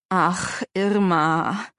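weary, tormented sigh of a name; pained. A narrator's reading. Uncle answering his pressing wife, pained. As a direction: groan it as if cornered. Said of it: "Stimme klang gequält".

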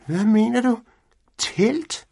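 high, curious cat voice; a small cat asking what a tent is; puzzled Findus. A narrator's reading. Cat asking Pedersen about the strange word, high and puzzled. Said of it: "spurgte Findus".

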